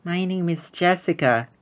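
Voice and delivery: put-on new girl shyly telling Ruthie her name; shy little girl. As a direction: say your name as a shy five-year-old. The girl introduces herself.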